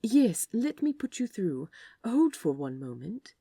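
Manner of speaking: smooth, polished phone greeting; the easy customer-service voice he's used a thousand times; practiced receptionist. Acted. Aziraphale answering the office phone, smooth practiced receptionist voice. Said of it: "practiced ease".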